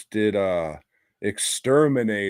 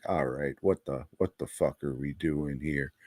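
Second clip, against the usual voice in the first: softer, lower, deadpan groan of disbelief; mock weary aside; 5 semitones lower, 8 dB quieter than usual. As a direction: mutter it like a tired crew member. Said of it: "it gets raunchy without being like".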